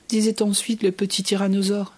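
ordinary delivery

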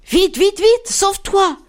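high, hurried warning; little rabbits urging a friend to flee; urgent squeaks. A narrator's reading. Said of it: "clapissent les lapereaux".

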